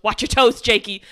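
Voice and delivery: loud, comic heads-up; imitated warning shout. Man shoving a wheelchair through swinging doors, comic warning shout. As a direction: call it out like a brash Brooklynite.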